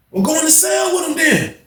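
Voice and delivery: high, tossed-off call from upstairs; mock high call. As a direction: brush it off from across the tier. Jeff calling down dismissively.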